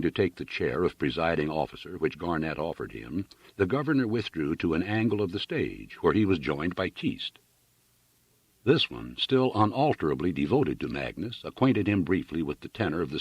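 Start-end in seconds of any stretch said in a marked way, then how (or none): none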